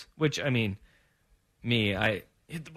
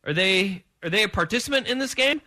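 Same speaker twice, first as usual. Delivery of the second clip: higher and fast, mock disbelief; mock incredulous; 5 semitones higher, 3 dB louder, faster than usual. Host mimicking himself watching the game, mock disbelief. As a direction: fire off the questions in sarcastic disbelief. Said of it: "I was going".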